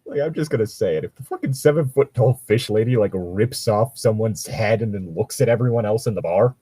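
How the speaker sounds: normal talking voice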